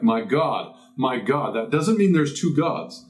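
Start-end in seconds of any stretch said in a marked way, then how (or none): none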